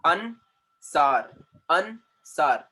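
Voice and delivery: "Ansar" in two beats with a gap after An; split into syllables. Shown as a demonstration. Teacher answering what a syllable is, "Ansar" split in two. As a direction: say "Ansar" piece by piece, An, then sar. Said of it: "So what is a syllable?".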